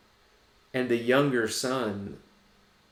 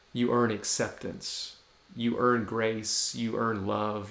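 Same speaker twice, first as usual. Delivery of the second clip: usual speaking voice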